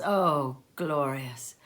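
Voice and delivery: ordinary delivery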